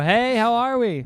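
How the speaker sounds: high, loud, slow host greeting; mock host greeting. To guests at the door, a loud cheery host greeting. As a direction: sing out a welcome to the guests. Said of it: "That's what I do".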